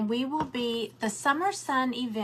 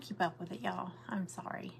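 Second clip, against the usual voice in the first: soft whispered aside; whispering; 3 semitones lower, 9 dB quieter than usual. Real, not acted. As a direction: drop to a whisper.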